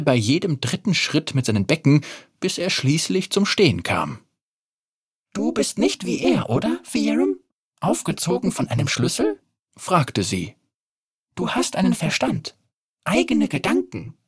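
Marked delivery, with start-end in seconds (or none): 5.3-14.2 put-on curious mechanical girl. Narrator voices girl Oriana.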